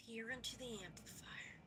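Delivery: soft whispered repeat; hushed echo. Pretend. Player echoes the game's instruction, whispering. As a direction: whisper it to yourself under your breath.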